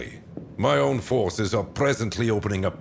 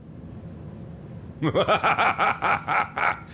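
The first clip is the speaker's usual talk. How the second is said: high, rapid cackling laugh; a long villainous chuckle rising high; theatrical laugh; 11 semitones higher than usual. Acted. Dynasty leader laughs while briefing the general, theatrical cackle.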